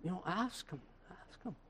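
barely audible sideways aside; very soft, furtive prompting; hushed urging. Acted. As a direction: murmur it softly, nudging someone to ask. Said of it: "Peter motioned to me".

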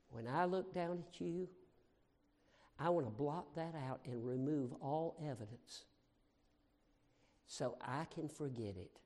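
low, soft, tender; tender voice of God. Retold or imitated. God speaking tenderly to the preacher, low and soft. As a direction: speak like a loving father. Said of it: "And the Lord said".

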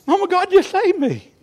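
high-pitched imitation of an excited child; excited little girl. Voices newly saved girl to mama.

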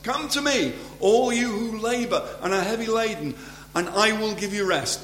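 high, ringing scripture quotation; proclaimed invitation. Acted. Preacher quotes Jesus's promise to the weary, proclaimed invitation. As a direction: call it out high, easing down toward the end.

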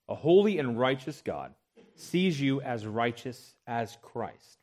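ordinary talk, nothing marked

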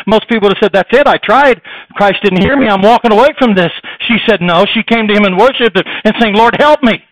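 loud, high, ringing sermon delivery; full-force preaching. Acted. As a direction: preach it out to the whole congregation.

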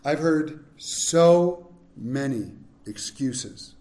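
drawn out slowly, low and tired; weary slow. Acted. Preacher sighing over excuses he has heard, slow and weary. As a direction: let the weariness stretch each word. Repeated line, slower.

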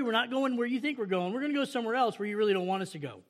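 usual speaking voice

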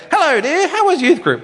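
preacher voicing a grandma greeting her grandchild, high granny voice. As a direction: put on a twittering granny. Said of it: "that's my grandma voice".

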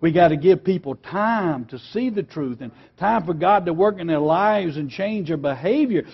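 ordinary delivery

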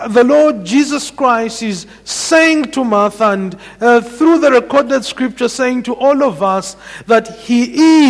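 measured sermon delivery, weighty and deliberate; preaching. Acted. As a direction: preach it to the congregation. Preacher expounding scripture.

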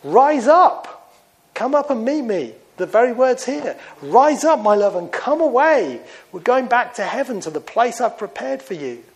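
high, loud, joyful call; ringing voice of the Lord calling his people home. Acted. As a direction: proclaim it as the Lord summoning his church. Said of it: "He says".

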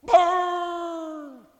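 drawn-out mocking crowd "ooh"; jeering coworkers. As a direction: imitate onlookers relishing a put-down, stretching each word. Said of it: "they go".

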